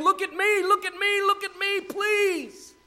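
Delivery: very high, pleading street cry. Acted. Wisdom begging passers-by from the street, high pleading cry. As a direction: cry out desperately for attention. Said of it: "crying out from the street saying".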